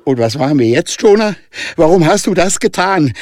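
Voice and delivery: high, loud horrified cry. Acted. Danica horrified at Jonah, high and loud. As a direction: shriek the question in horror. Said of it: "fragte Danica voller Entsetzen".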